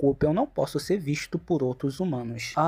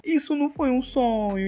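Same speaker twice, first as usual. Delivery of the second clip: higher, lightly voiced young woman; Suha's inner voice; 8 semitones higher than usual. Suha realizing it wasn't a dream, higher thought voice. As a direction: lift it up into the heroine's thought.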